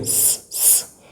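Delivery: "S" as a soft voiceless hiss; just hiss the "S", twice, softly; isolated S sound. Shown as a demonstration. Teacher showing what the cédille signifies, soft hissed S. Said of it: "That's what cédille signifies".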